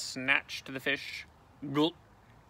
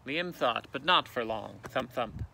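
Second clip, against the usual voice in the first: ordinary delivery, nothing marked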